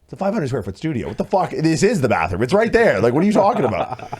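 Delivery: can't believe the question, scolding; exasperated. Retold or imitated. Boyfriend exasperated at sleepwalking girlfriend asking for the bathroom. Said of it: "I'm like".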